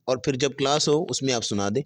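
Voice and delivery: ordinary talk, nothing marked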